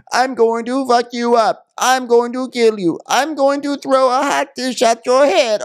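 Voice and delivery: very high, slow mock threat; high-pitched caricature of menace; super aggressive. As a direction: mimic an aggressor, drawing out each threat up high. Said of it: "came at me super aggressively".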